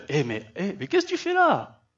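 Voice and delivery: laughing, then a very high amazed question; God teasing his hiding prophet; high mock incredulous question; God's amused astonishment. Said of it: "Dieu lui répète".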